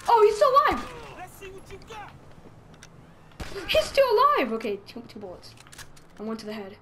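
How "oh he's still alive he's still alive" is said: high, loud cry of disbelief; shocked exclamation. Real, not acted. Player finding the shot man still alive, loud shock. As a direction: yelp it in loud amazement.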